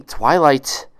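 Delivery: snapped name, loud and cold; sharp snap. Acted. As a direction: cut her off with a sharp snap. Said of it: "Nurse Ratchet snapped".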